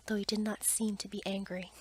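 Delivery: plain reading voice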